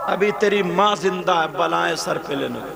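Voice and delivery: high, loud, slow weeping sermon; impassioned preaching. Acted. Preacher crying out the story of Uwais and his mother. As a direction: preach the mother's grief at full voice.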